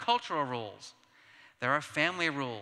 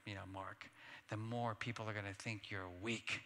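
much softer, lowered voice; hushed warning; 5 semitones lower, 9 dB quieter than usual. Retold or imitated. Brother quietly warning Mark about sharing, hushed. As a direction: lean in and warn him softly. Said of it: "And then my brother says".

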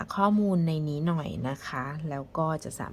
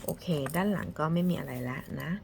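ordinary talk, nothing marked

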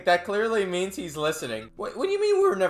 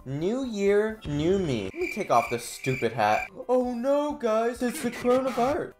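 ordinary talk, nothing marked

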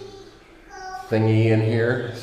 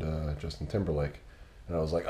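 ordinary talk

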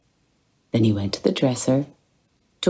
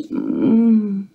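sleepy grunt; 10 semitones higher, 4 dB louder than usual. A narrator's reading. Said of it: "A sleepy, soft grunt answered".

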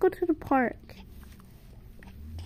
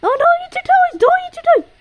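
high, loud shouted scolding; a hammed-up parent's shriek; 9 dB louder than usual. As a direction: yell at the baby to stop. Scolding baby.

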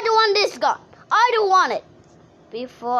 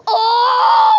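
loud, high "oh" held long; drawn-out scream; 6 semitones higher, 8 dB louder than usual. Real, not acted. Player flying in the game, drawn-out scream. Screaming as flight goes wrong.

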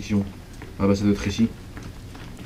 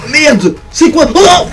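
loud, high startled yells; panicked shout; 8 dB louder, much slower than usual. Real, not acted. Player attacked from nowhere, shouting in panic. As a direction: caught off guard, shouting in real panic.

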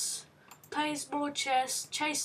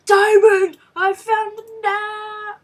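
excited gasp and much louder cry of the word; thrilled discovery; over-the-moon treasure find; gasp then loud shout; 6 semitones higher, 12 dB louder than usual. Real, not acted. Finding a diamond in game.

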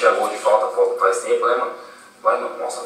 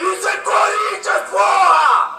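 taunting shouts; 3 semitones higher, much slower than usual. Real, not acted. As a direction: rub it in, shouting. Taunting rivals after goal. (gritos de provocação)

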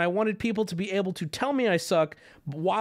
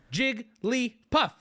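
JIGGLYPUFF in three separate beats, big gaps between; broken into syllables; 6 semitones higher, much slower than usual. Shown as a demonstration. Streamer teaching chat the syllables, spaced out. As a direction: slowly spell out Jigglypuff syllable by syllable, higher. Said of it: "Big spaces in between them".

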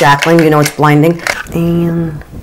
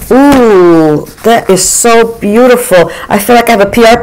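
gushing delight; 5 semitones higher than usual. Real, not acted. Beauty vlogger gushing over a product, delighted. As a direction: swoon over it like a gift.

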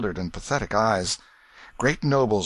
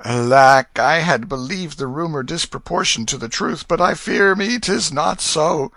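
loud lament, climbing higher; deep disappointment; 8 semitones higher, 8 dB louder than usual. A narrator's reading. King finding the rumour of his son true, deep disappointment. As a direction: lament it with a strong opening cry. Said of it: "in a tone of deep disappointment".

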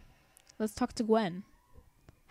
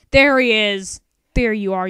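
loud triumphant call; 12 dB louder than usual. Real, not acted. Finds the rival character.

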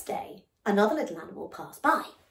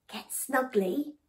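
plain reading voice

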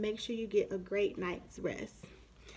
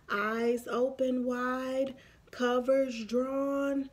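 plain reading voice

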